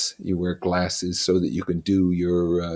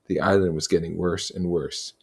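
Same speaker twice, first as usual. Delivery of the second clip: ordinary delivery, nothing marked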